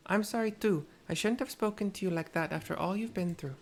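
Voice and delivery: put-on Tepeke's mixed accent, apologetic. Tepeke apologizes to the girl in her mixed accent. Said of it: "the accent I've chosen".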